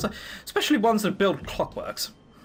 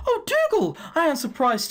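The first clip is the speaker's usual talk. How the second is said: sudden leap higher on the name; surprised; 9 semitones higher than usual. Acted. Preacher Heather spotting Dougal in the crowd, surprised. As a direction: spot him and light up.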